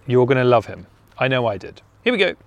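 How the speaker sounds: normal talking voice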